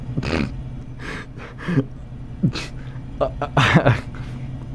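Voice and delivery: long wheezing laughing fit. Real, not acted. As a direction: crack up until the breath runs out. Cracks up at drunk joke.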